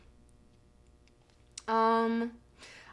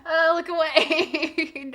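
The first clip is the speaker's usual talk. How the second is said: laughing tearful; 4 semitones higher, 5 dB louder, much slower than usual. Real, not acted. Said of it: "I'm gonna get emotional".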